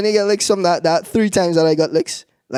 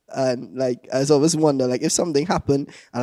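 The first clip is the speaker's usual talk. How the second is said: ordinary delivery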